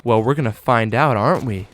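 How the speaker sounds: low, soft imitation of a flirty teacher; suggestive teacher. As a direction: drop low and quiet, mock-coy. Teacher voiced being suggestive.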